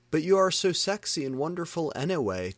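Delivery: gushing praise. A narrator's reading. Vampire gushes to Enobi.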